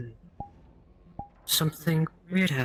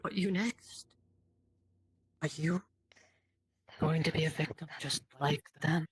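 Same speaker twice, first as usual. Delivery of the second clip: ominous narration; 2 semitones higher, 9 dB quieter than usual. Acted. Game master narrating the player's panic, softly ominous. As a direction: pull them into the dread.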